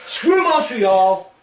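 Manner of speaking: loud, high, drawn-out jeer; playful shouted dig at a rival city; mock defiant shout. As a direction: holler it slowly as a joke insult. Mock outburst against Montreal.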